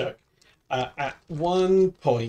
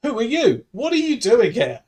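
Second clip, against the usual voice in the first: higher, barked demand from a lightly voiced gunman; 6 semitones higher than usual. Gunman challenges two lost truckers, lightly voiced. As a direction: play the armed man challenging strangers. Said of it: "I will be man with gun".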